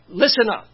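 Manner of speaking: loud emphatic listen up calling for attention. Retold or imitated. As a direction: bark listen up at the crowd. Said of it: "or with emphasis".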